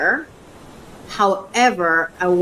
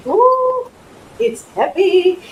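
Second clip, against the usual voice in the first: surprised ooh; 11 semitones higher, 3 dB louder, slower than usual. Real, not acted. Lifting the heavy tub.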